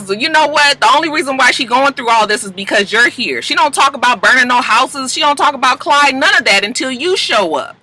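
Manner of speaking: acted indignant daddy; accusing father. Daddy blaming Annalise in argument.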